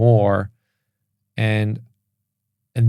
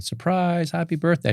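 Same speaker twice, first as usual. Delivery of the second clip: higher, playful imitation of his kids; kids shouting surprise; 9 semitones higher than usual. His kids surprising dad on his birthday, lightly imitated high. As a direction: lightly mimic excited children springing a surprise.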